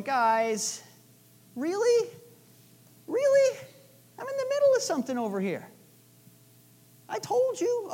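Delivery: high, incredulous Really? with an exasperated eye roll; cosmic eye roll. Retold or imitated. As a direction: play an exasperated Jesus, pitch shooting up in disbelief. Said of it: "this wonderful cosmic eye roll of Jesus going".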